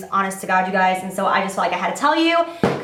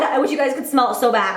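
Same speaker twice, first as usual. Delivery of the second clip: normal talking voice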